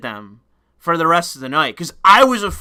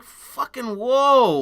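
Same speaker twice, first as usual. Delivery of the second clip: stunned whoa; 8 semitones higher, much slower than usual. Retold or imitated. Backing off from the EMT.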